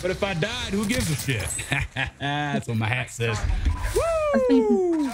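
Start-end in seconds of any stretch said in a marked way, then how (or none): 3.9-5.0 loud, high-pitched woo; long, soaring whoop; celebratory whoop. Real, not acted. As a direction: let out a triumphant holler. Celebrates at the game.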